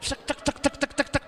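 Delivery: high, rapid chugging syllables; imitated cash-counting noise. Preacher showing how money sounds in the machine, excited imitation. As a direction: chatter like banknotes being counted. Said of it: "nek muni ngono kan duit".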